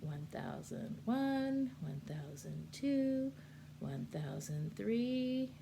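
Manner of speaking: drawn-out counting of seconds; slow deliberate count. Shown as a demonstration. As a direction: count each second slowly and evenly. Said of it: "give them a couple of seconds".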